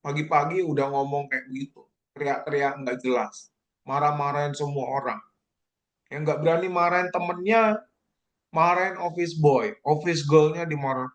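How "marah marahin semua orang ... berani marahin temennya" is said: ordinary delivery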